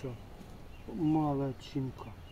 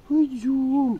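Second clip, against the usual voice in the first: talking to a dog; 10 semitones higher, 8 dB louder than usual. Real, not acted. Calling the dog.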